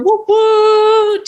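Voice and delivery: high, loud whoop whoop; mock celebration. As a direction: cheer it up high.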